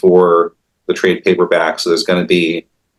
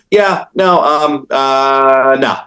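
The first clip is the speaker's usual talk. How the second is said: higher, hammed-up awkward no; imitating a big guy brushing it off; playful higher mock refusal; mock flat refusal; 5 semitones higher, much slower than usual. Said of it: "he goes".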